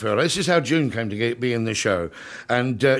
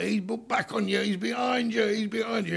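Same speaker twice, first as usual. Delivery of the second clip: mimicked kids shouting; 10 semitones higher, 5 dB quieter than usual. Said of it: "they're all shouting out".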